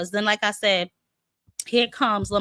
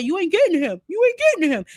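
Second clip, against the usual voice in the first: imitating, higher and louder; mock heated quote; 7 semitones higher, 5 dB louder, faster than usual. Mimicking Ray Ford taunting that you ain't getting him. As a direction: squeak it up like an amped fighter. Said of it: "when he said".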